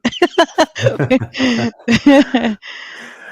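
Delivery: long falling laugh. Real, not acted. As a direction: laugh it off at length. After the quote.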